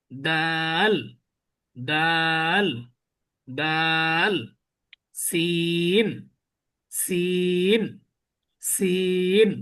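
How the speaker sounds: each letter name stretched long and even, like a teacher reciting; drawn-out recitation. Shown as a demonstration. Teacher recites the letters slowly for students to copy. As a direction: draw every vowel out slowly and clearly. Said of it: "বাকিগুলো টেনে টেনে উচ্চারণ করছি".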